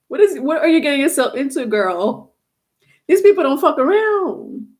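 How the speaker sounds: high-pitched, put-on warning voice; talking like this. Reader warns client about serious finance people, high put-on voice. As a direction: pitch it well up as you warn her. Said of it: "and I keep talking like this".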